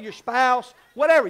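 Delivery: building to a loud playful whatever; loud joking flourish. Real, not acted. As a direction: toss it off with a loud laugh in the voice. Joking aside, rising loudly.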